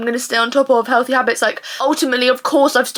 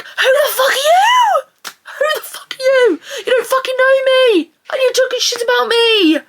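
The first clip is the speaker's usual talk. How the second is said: very high, outraged shouting; indignant screech; 12 semitones higher than usual. Acted. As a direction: screech it at an absent gossip. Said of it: "I'm just like".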